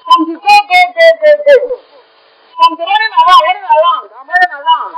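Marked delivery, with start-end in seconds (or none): none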